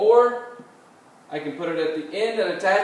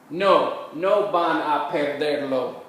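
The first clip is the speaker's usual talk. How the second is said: slow, measured Spanish for learners; slow model answer; slower than usual. Shown as a demonstration. Teacher modelling a practice answer for students, slowly and carefully. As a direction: draw out each word so learners can follow.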